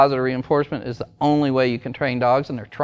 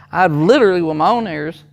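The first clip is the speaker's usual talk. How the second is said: normal talking voice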